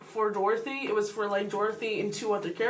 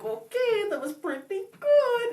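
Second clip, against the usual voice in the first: high, drawn-up imitation of polite judges; noncommittal judges; 10 semitones higher, slower than usual. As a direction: imitate their unsure praise, pitched way up. Retold judges' hard-to-read verdict.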